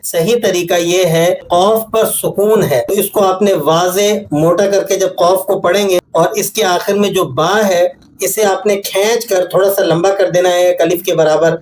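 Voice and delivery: ordinary talk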